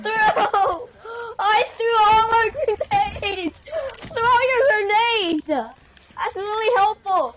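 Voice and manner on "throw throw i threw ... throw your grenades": high, frantic calls to teammates; urgent yelling. Real, not acted. Player to teammates about grenades, urgent yelling. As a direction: yell for help in a panic, pitched up.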